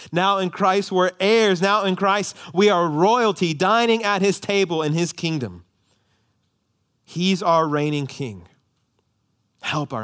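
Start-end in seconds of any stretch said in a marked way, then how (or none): 0.1-8.5 louder, higher preaching, building in a triumphant list; rousing proclamation. Acted. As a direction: proclaim each line like a victory. Rising proclamation of victory.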